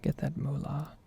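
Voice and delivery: ordinary talk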